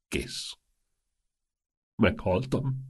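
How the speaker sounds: faint, spent groan. A narrator's reading. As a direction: groan it out as if completely finished. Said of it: "nyögte".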